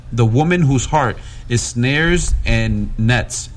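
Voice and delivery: firm, emphatic Bible reading; scripture reading. Acted. Interpreter reads chapter seven to congregation, scripture reading. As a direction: read the passage out with weight and steady force.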